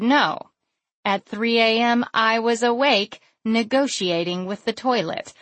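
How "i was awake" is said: ordinary delivery, nothing marked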